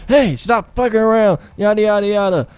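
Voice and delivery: high-pitched mock yelling; imitated yell. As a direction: mimic her yelling from the other room. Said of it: "hearing her yell out there".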